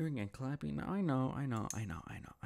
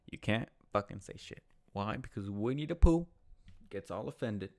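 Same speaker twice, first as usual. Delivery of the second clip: normal talking voice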